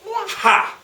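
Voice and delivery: high, loud scoff; bold, high-pitched defiance; brave defiance. Acted. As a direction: fling it back defiantly. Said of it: "Because she was very brave".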